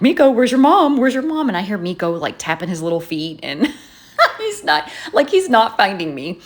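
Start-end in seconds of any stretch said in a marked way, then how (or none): 0.0-1.5 calling out. Retold or imitated. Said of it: "I hear him like".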